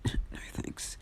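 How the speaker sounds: soft breathy whispered answer; whispered reply. Retold or imitated. Dazed Izuku answers All Might in a hushed whisper. Boy declines hero's signature.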